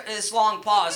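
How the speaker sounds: normal talking voice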